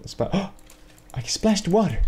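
high startled gasp, then a laugh; delighted surprise at a tiny discovery. Real, not acted. Gamer discovering the water splashes, surprised then laughing.

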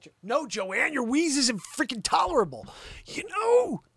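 high, squealing mock complaint; exasperated outburst. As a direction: whine it high, rising to a squeal. Hammed-up outburst at wheezing Joanne.

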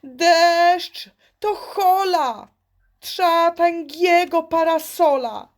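the little frog cries out its warning; frog shouting. Acted. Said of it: "I jak krzyknie". (wysoki okrzyk żabki)

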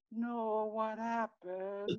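high and very slow; lightly voiced girl, drawn out; other niece's voice. Uncle voicing the second niece, high and slow. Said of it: "another voice goes".

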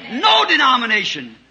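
rising to a shouted climax; fiery preaching. Real, not acted. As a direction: hammer the point home from the pulpit. Preaching burst.

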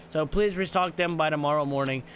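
usual speaking voice

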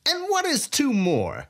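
put-on gloating thief greeting an old rival; gleeful Sai. A narrator's reading. Sai voiced by narrator.

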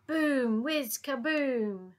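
loud, high exclaimed bangs; booming firework noises. A narrator's reading. Narrator setting off fireworks over London, booming. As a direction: voice each firework explosion with full energy.

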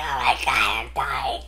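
low, soft, spent laughter-filled words; dropped-down, laughing murmur; overwhelmed. Real, not acted. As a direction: croak it out, worn out from squealing.

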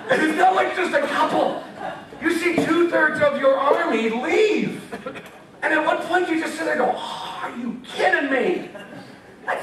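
can't believe the whole army left; laughing incredulity. Real, not acted. Laughing, climbing indignation.

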